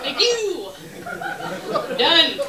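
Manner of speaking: loud, high victorious whoop; triumphant cheer. Retold or imitated. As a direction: celebrate like you won a game.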